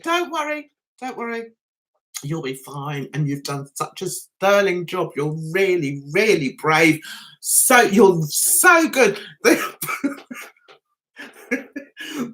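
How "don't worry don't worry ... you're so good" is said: soothing. Retold or imitated. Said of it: "This woman next to it".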